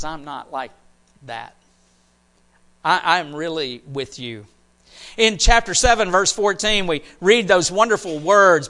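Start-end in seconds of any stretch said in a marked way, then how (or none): 0.0-4.5 low, soft and intimate; quiet. Acted. God answering the idol makers, low and soft. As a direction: drop low and close.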